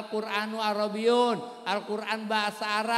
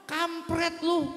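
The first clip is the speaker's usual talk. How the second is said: put-on cheeky Betawi kid; 7 semitones higher, much slower than usual. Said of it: "kata anak Betawi".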